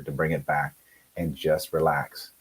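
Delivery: ordinary delivery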